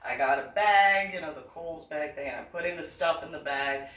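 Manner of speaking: ordinary talk, nothing marked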